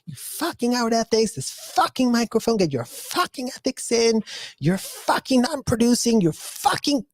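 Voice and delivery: high, mocking rant of a cursing woman; mock swearing lady. Imitating ladies cursing at kids in a high mock voice. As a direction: spit out the curses like a made-up lady.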